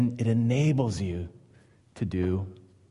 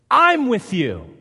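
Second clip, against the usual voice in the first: emphatic reassurance; 8 semitones higher, 6 dB louder, slower than usual. Acted. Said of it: "preaching God's reassurance".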